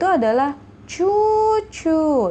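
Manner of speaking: "cucu" stretched slow and high; long vowels. Shown as a demonstration. Teacher shows students the long "cucu", slow and high. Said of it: "Ini panjang ya".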